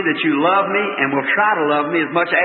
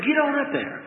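high-pitched eager prod; high, insistent retold urging; 11 semitones higher, 5 dB quieter than usual. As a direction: urge a friend on, voice jumping high. Urging the preacher onto the platform.

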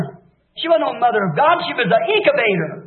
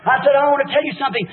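normal talking voice

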